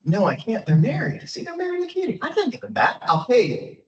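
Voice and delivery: high, mock-innocent protest; squeaky high governor dodging the British; governor's excuse. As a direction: play the governor feigning helplessness.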